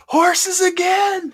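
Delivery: high, loud, drawn-out exclamation; mock outcry. Joking about repeated horse insults, mock outcry. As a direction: cry it out high and stretch it.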